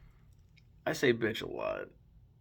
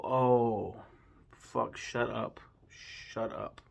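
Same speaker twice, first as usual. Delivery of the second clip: exasperated; much slower than usual. Real, not acted. Annoyed at the rap.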